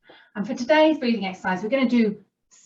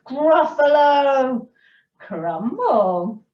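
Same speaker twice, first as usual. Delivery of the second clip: drawn-out dish name at storyteller's pitch; mouse's teasing threat; 4 semitones higher, 5 dB louder, much slower than usual. A narrator's reading. Mouse threatens to eat the Gruffalo, measured acted threat. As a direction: savour it as the mouse's menu. Mouse names favourite food menacingly.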